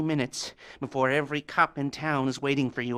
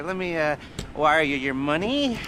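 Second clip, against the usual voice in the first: ordinary talk, nothing marked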